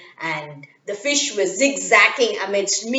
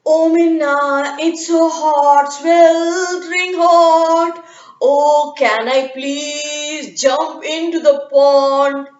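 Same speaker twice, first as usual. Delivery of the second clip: very slow, louder, sing-song pleading; otter voice; 4 semitones higher, 5 dB louder, much slower than usual. Acted. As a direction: stretch every word like a lazy otter. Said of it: "he asked".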